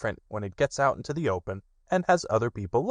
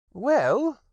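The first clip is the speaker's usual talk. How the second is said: high, pleased rabbit voice; 4 dB louder than usual. Acted.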